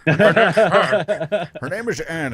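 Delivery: can't hold it together telling her stats; laughing. Real, not acted. Cracks up describing Anne.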